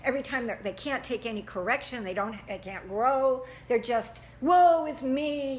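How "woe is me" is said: mock lament. Victim lament acted out.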